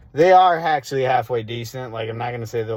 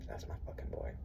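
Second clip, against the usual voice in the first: fond, much softer whispered aside; 22 dB quieter, faster than usual. Real, not acted. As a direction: breathe it out to yourself. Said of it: "That's my boy".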